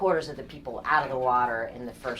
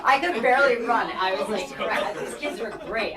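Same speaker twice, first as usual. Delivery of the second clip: laughing; 7 semitones higher, 4 dB louder than usual. Real, not acted. Laughing while retelling.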